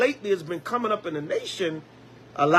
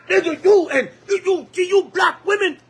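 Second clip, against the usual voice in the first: high, loud, ranting shout; mock angry cursing; 10 semitones higher, 4 dB louder than usual. Husband cursing out his wife, loud mock rant. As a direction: rant at your wife in a fury.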